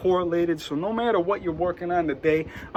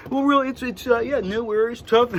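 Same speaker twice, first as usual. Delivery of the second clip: mock San Diego surfer accent. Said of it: "that's a San Diego, uh, accent right there".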